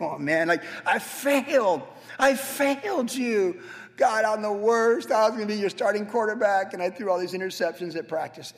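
hammed-up whining Sid from Toy Story; whiny Sid. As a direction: wail like a kid who broke his toy. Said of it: "like Sid in the first Toy Story".

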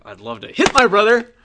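loud, high mock aggression; itching to hit. Fed-up sibling talking about a brother, mock aggressive. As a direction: burst out on "hit" with pent-up sibling frustration. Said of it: "Or is it like".

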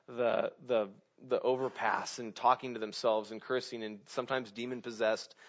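normal talking voice

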